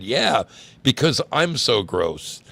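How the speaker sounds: slow, sarcastic playing along. A narrator's reading. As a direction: drag it out with mock agreement. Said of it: "she sarcastically played along and said".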